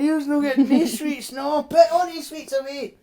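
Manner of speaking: high, hammed-up scolding mum. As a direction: tell him off like his mum. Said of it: "Your mum will be like".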